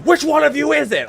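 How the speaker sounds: high, loud accusing yell; a paranoid rider shouting at the cabin. Retold or imitated. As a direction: hunt for the spy at the top of your voice. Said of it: "I just start yelling".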